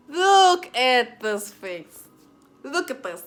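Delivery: high, loud, very slow Look at this face; mock indignant. Real, not acted. Showing viewers his innocent face, mock indignant. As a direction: stretch out Look at this face in exaggerated outrage. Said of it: "repeated louder, drawn out".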